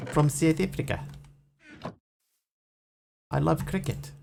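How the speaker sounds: playful South African impression; South African accent. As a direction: speak like a caricature South African. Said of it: "That's pretty good".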